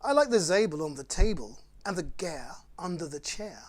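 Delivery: lively, sing-song picture-book read; bouncy storytime rhyme reading; reading to children. Acted. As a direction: read it like bedtime fun for little ones. Reads Dr Seuss to children.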